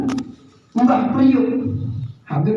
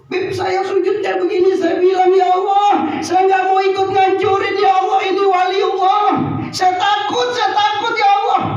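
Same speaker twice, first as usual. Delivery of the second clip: imitated tearful pleading; 7 semitones higher than usual. Preacher re-enacts the officer praying in prostration, imitated tearful pleading. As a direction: act out a frightened man crying out to God. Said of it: "Ini dia begini nangis".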